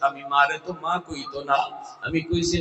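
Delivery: ordinary talk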